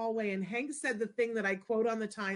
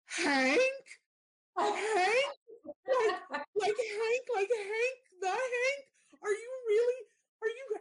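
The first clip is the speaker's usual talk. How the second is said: high, tearful, breaking voice as she finally meets him; crying out in disbelief; 14 semitones higher, slower than usual. Real, not acted. Meeting her long-awaited colleague, crying and squealing. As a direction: voice cracking with overwhelmed tears. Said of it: "And I started to cry".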